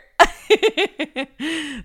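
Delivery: loud long laugh; hearty laugh. Real, not acted. Mom laughing at her son's quote, a big laugh.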